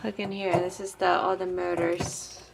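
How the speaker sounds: normal talking voice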